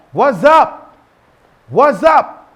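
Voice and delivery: loose, high, loud casual "What's up?"; casual greeting. Shown as a demonstration. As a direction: toss off "What's up?" like greeting a buddy. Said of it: "someone would just say something like".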